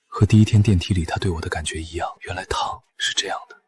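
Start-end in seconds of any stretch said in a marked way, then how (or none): 2.2-3.6 very soft, whispery inner thought; hushed realisation. Acted. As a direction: breathe it to yourself as it dawns. Man's private realisation, inner monologue. (低声恍然自语)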